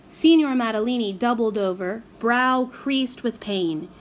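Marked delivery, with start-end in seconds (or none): none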